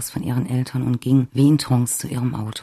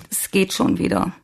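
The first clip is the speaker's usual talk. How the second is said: higher voice, tearful and sniffly; sniffling; 6 semitones higher than usual. A narrator's reading. Svenja to the nurse at her car window, sniffling. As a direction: sniffle it out after crying. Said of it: "schniefte sie".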